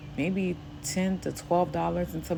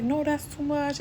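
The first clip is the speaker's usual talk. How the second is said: high protest; 11 semitones higher than usual. Retold or imitated. Lady refusing money.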